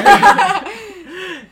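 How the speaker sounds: loud high cackling laugh; big delighted laugh that cracks up; burst of laughter. Real, not acted. Cracking up at the joke title.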